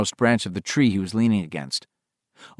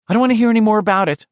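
fast, higher, clipped mother's dismissal; flatly; 11 semitones higher, 6 dB louder, much faster than usual. Acted. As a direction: shut it down fast. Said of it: "Maggie Tozier said flatly".

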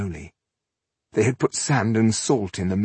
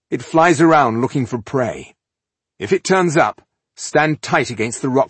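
loud, raised urgent warning; 6 semitones higher, 7 dB louder than usual. A narrator's reading. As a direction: warn them firmly. Dwarf warns group of beast.

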